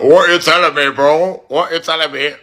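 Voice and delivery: high, mimicked English accent; mocking English accent. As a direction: parrot it back in a mock English accent. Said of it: "Just the accent".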